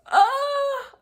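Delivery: loud, very high imitated scream. Mother retelling her arrival at the hospital, screaming. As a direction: scream out high and loud, acting labour pain. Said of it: "I'm screaming and everything".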